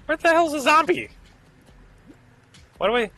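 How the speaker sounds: high, loud startled yelp; startled shout. Real, not acted. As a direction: shout it in sudden alarm. Sudden alarm at zombie.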